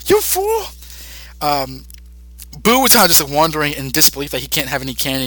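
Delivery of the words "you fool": mock yelling. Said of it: "start yelling at each other".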